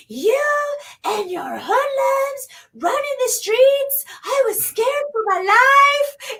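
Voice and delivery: whiny neighbor. Retold or imitated. Mocking the neighbor who complained about fireworks, high whiny voice. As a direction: mimic the scared neighbor, high and drawn out. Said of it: "And she's like".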